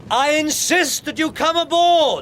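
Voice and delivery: loud insistent hail; shouting. Acted. Captain urges the stranger aboard, loud high shout. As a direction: shout the order high across the ice.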